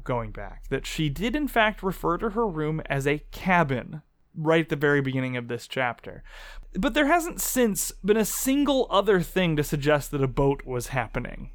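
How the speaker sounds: normal talking voice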